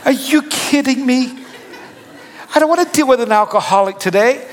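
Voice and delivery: exasperated disbelief. Retold or imitated. Inner reaction to stranger's confession.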